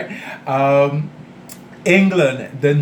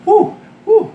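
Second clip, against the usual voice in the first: two very high, loud woos; high whoop; 6 dB louder than usual. Real, not acted. Cook reacting to a hot roll, high whooping.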